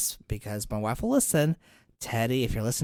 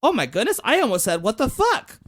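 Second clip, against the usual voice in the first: put-on higher, a touch louder stumbling child; lightly voiced son catching himself; startled kid; 8 semitones higher, 3 dB louder than usual. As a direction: blurt it like a tripping kid. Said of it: "he nearly trips and goes".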